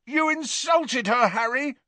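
very high, indignant shout; outraged, high-pitched protest. A narrator's reading. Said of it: "shouted Ron".

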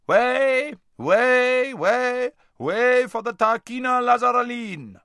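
high, loud street crier's call; crier shouting out. A narrator's reading. A crier clearing the street for Lazareline, shouting. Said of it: "was heard shouting out".

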